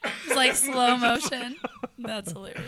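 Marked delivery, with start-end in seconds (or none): 0.0-1.6 drawn-out slow-motion voice. Pretend. Mocking slow-motion fall.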